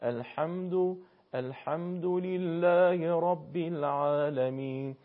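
الحمد with throaty ح and thin a; slow, drawn-out recitation, ح in الحمد kept light; correct light ḥā. Shown as a demonstration. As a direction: chant الحمد لله without thickening the vowel after ح. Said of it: "اگر درشت و پرحجم تلفظ بشه اشتباهه".

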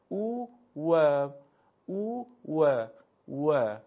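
slow, loud drill of «او و», oo then wa; u glide into waw. Shown as a demonstration. Teacher gives a trick for waw, «او» held before «و». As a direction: hold «او» as oo, then open into a rounded wa. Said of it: "از همینجا نگه داشته باشید، بعد حرف واو رو تلفظ بکنید".

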